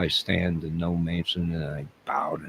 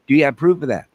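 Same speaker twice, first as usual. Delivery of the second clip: higher, louder questioning as a detective; playing the interviewer questioning himself, higher and louder; mock interrogator; 6 semitones higher, 8 dB louder, much faster than usual. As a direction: grill yourself like a cop. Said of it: "I'm gonna interview myself".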